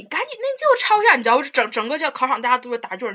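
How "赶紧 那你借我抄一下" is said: much higher mock loud whisper-shout; a hint of a pushy classmate calling across the exam; imitating a pushy classmate. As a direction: play the classmate begging for answers. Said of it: "故意压低那个声儿，然后声儿还非常大".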